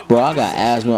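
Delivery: ordinary talk, nothing marked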